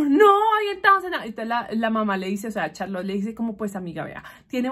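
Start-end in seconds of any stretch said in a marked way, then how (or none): 0.0-1.2 put-on high, loud teen protest. Daughter refusing to her mother, in a high loud protest. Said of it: "ella como".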